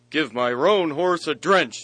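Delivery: loud, commanding Hotspur; Hotspur giving orders. Retold or imitated. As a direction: bark out the order to the stable. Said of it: "says he".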